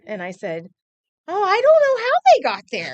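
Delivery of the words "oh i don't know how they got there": put-on high, loud little-girl fib; five-year-old protesting innocence to mom, high and loud; innocent child voice. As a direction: play the innocent kid caught red-handed.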